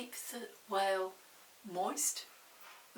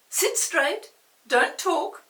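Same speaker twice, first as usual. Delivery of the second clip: loud, higher bossy teacher command; strict teacher; 8 semitones higher, 14 dB louder, slower than usual. Acted. Teacher ordering the class to sit still, loud stern command.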